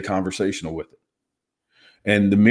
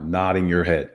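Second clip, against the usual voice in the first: ordinary delivery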